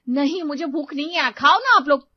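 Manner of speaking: wife refusing to eat, loudly upset; angry refusal. Acted. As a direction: push them away, hurt and angry. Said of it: "वो गुस्से में अपने कमरे में चली गई".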